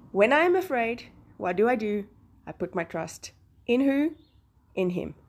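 ordinary talk

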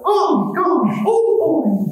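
very high falsetto Mickey Mouse voice. Shown as a demonstration. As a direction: pop up into a squeaky falsetto mouse. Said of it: "if you do that falsetto".

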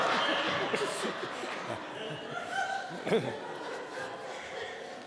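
long unvoiced laugh trailing away; fading wheeze of laughter; long breathless laugh. Real, not acted. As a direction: crack up helplessly. Breaks up laughing.

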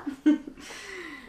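short giggle from a puppet; puppet laugh. Acted. Puppet laughs after remark. (hohes Puppenlachen)

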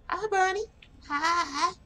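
put-on high sing-song baby voice; reading to a toddler. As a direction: greet him like a toddler. Greeting the animatronic bunny.

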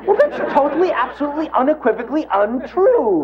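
high, indignant overemphatic denial; superlative protest. Pretend. Acting out his criticism.

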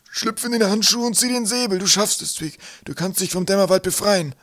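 high, loud rallying cry; rally him with force; urgent encouragement. A narrator's reading. Professor pushing Twig to break free, urgent rallying.

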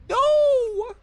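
sudden very high-pitched outcry; high cry. Real, not acted. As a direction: cry out in a long, high note. Sudden cry reacting to the stream.

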